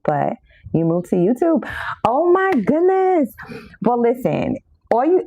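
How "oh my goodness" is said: surprised. Real, not acted. Surprised at viewer moving to YouTube.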